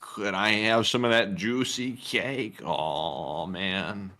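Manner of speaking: low, slow, put-on beggar voice; hushed, drawn-out pleading. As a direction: beg softly, drawing the words out. Begging bit in put-on voice.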